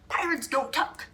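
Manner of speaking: high, stubborn crew protest; mock-gruff pirate chant; yelled. A narrator's reading. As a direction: shout it back, flat refusal. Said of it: "the crew yelled".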